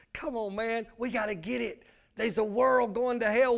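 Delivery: ordinary talk, nothing marked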